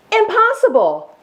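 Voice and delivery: high, startled cry of disbelief; shocked disbelief. Acted. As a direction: blurt it out in amazement. Farmer's disbelief.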